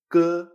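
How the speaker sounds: unaspirated soft k sound. Shown as a demonstration. Teacher showing students the Chinese G, k as a soft unaspirated K. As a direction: say k softly with no puff of air. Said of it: "Como una K suave".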